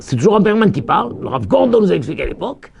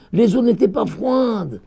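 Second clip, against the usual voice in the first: ordinary talk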